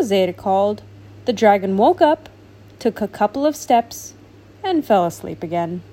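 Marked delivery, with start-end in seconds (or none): none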